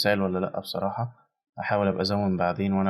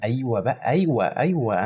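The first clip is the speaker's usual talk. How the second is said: higher, louder yes yes; thrilled cheer; 3 semitones higher, 4 dB louder, slower than usual. Real, not acted. Vlogger cheering bloody trailer shots, thrilled and loud. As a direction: cheer the gore on like a fan.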